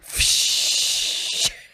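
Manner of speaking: long loud hissing rush; loud "pshhh" of streaming liquid; imitated gushing pee. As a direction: make the noise of a bladder emptying full force. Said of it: "All you hear is".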